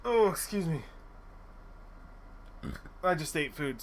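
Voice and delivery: ordinary talk, nothing marked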